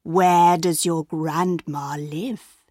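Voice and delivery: low, slow sly wolf; sly predator asking slowly. A narrator's reading. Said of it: "he asked".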